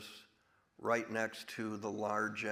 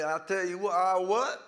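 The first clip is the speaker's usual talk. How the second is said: higher, louder, overblown Southern drawl as Charlie Croker; big Southern accent; 8 semitones higher, 11 dB louder than usual. Shown as a demonstration. Actor shows how Charlie comes in big, in a hammy Southern accent. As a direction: ham up a larger-than-life Southern accent.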